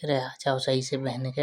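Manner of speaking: ordinary talk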